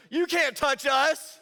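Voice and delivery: loud, high, drawn-out taunt; mock taunt. Friend daring a haunted-house clown, loud taunting. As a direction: jeer it slowly at full voice.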